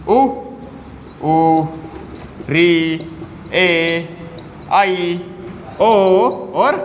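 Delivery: each vowel sung out from the mouth only; clear oral vowels. Shown as a demonstration. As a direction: sound out ए, आ, ई… purely through the mouth, no nasal. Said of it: "क्या आ जाएंगे इसमें?". (मुंह से साफ़ स्वर उच्चारण)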